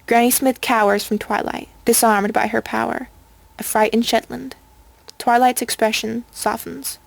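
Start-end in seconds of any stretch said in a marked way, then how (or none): none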